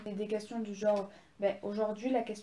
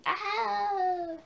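high, loud "ah" cry; sharp rise into a drawn-out shriek; startled yell; 10 semitones higher, 6 dB louder than usual. Real, not acted. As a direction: yelp out suddenly mid-sentence. Startled yell mid-sentence, fly about.

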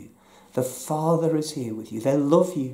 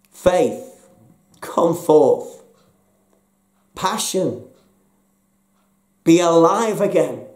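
builds from plain to a loud, high call; commanding call out of the tomb; building command; 4 semitones higher, 7 dB louder, slower than usual. Acted. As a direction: summon each one louder than the last. Preacher calls forth hope, healing.

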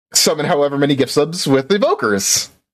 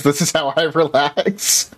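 ordinary delivery

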